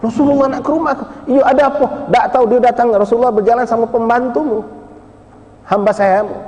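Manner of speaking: high excited neighbours sharing the news. Acted. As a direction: break the news breathlessly. Excited exchange at the house.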